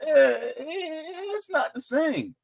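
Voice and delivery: high, drawn-out whine; high-pitched, hesitant dismissal; reluctant dismissal. Acted. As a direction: whine it slowly, unconvinced. Voicing people's unimpressed reaction.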